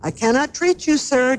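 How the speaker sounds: high, mild narrated shout. A narrator's reading. Doctor DeSoto turning away the fox, shouted. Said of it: "Doctor DeSoto shouted".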